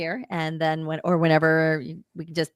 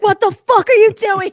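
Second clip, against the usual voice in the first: exasperated yell; 14 semitones higher, 10 dB louder than usual. Pretend. Watching show remotely, exasperated.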